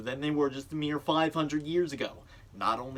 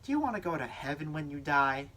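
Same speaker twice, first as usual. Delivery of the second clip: commercial voice-over; 3 semitones higher, 5 dB quieter than usual. Acted. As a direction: lure the listener in with a hush. Second commercial read.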